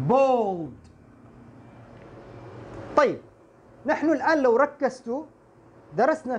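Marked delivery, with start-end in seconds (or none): 0.1-0.9 bold said with a rounded o vowel, clearly; model word. Shown as a demonstration. Teacher modelling the word bold for students. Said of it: "فمثل كلمة".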